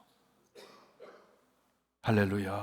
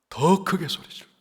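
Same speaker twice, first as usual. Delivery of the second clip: raised, urgent call of 더 크게 소리 질러; urging cry; 5 semitones higher than usual. Shown as a demonstration. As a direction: call 더 크게 소리 질러 out boldly. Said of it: "주눅 들지 않았어요".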